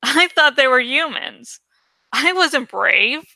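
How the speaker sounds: usual speaking voice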